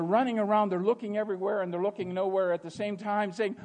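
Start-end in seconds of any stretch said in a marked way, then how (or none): none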